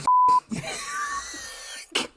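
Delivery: high squealing burst of laughter; can't hold it in, shriek with laughter; real laughing fit; helpless shrieking laugh. Real, not acted. Cracking up reading fan fiction.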